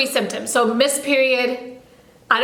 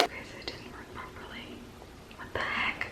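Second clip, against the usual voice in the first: hushed, voiceless whisper; barely audible whispered reaction; 19 dB quieter than usual. Real, not acted. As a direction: whisper it in baffled dismay. Secretly reacting to failed test.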